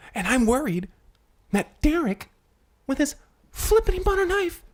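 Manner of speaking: high, loud fretting; rising agitation, peaking high on the knife; worried. Acted. Character frets to co-host about Derek and his butter knife, worried and loud.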